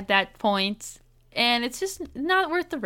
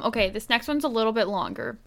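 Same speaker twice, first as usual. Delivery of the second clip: ordinary talk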